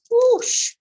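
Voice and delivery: high loud whoosh of wind. Acted. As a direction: blow it out like a gust of wind. Wind sound effect in story.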